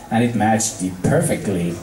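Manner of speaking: ordinary delivery, nothing marked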